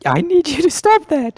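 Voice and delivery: tiny high-pitched insect voice; high squeaky cricket voice. Acted. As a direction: squeak out the order.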